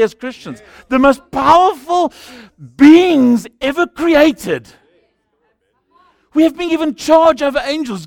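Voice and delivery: loud, high, driving sermon delivery; full-force preaching. Acted. Pastor exhorting the congregation to walk powerfully, full-force preaching. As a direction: preach it hard to the whole room.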